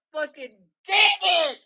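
loud, high exasperated curse; frustrated outburst. Real, not acted. Venting to listeners, a loud exasperated curse. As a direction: blow up at the whole situation. Venting rage about neighbors.

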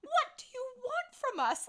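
high, loud protest; hammed-up indignant holler; mock exasperated yell. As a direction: shout back in pretend exasperation. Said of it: "We're like".

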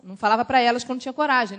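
normal talking voice

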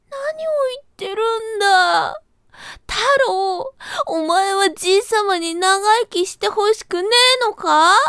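high, whiny crocodile-tears rabbit; fake tearful rabbit. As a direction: play wounded to guilt him. Rabbit fakes hurt feelings.

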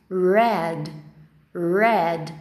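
"red" twice with a drawn English R; English initial R. Shown as a demonstration. Teacher models the colour word, English initial R. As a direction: say "red" low, as RRED, no H sound. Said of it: "Começa com R, então eu falo red".

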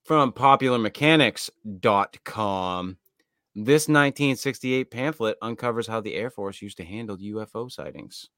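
usual speaking voice

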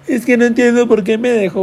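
high mock whiny lament; mock heartbroken whine. Mocking a heartbroken viewer, high whiny lament. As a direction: whine it like a dumped teen. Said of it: "que ahorita andas".

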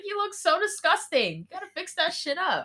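high-pitched appalled taunt; mock disgust. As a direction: pile on the exaggerated disgust. [name] taunts crying Maya.

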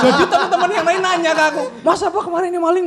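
high, fast talk through laughter; laughing. Real, not acted. Shopkeeper telling friends about gossip kids, laughing. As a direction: laugh hard while telling. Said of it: "laughing while telling".

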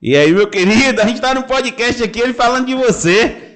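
high, loud shout into the phone; saudação gritada. Real, not acted. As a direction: holler a hearty greeting to a friend on a call. Grita para amigo na ligação. (falando bem alto e animado)

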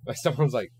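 ordinary delivery

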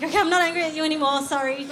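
loud, high, fast apology broken by laughter; laughing apology. Retold or imitated. Apologising while laughing at herself.

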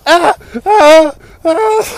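high, loud terrified wail; terrified cry. Pretend. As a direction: cry out in terror.

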